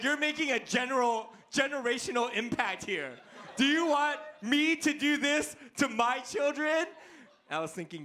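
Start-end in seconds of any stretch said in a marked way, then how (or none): 0.0-3.1 high, mock-indignant scolding; Liam. Mad Liam scolds his dad, mock indignation. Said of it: "when he's really mad at me". 3.5-6.9 high-pitched, hammed-up indignant protest; Liam. Mad Liam confronts his dad, hammed-up outrage. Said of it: "when he's really mad at me".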